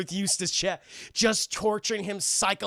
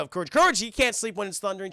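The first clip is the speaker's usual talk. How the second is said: ordinary talk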